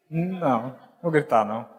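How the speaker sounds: low, soft, deadpan no; calm refusal. Retold or imitated. His calm refusal to yell.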